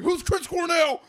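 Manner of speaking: loud, high yelled question; retold yelling. Stoned man questioning lady at bar, yelling. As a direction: shout it out, oblivious to the volume. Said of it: "Turns out I was yelling".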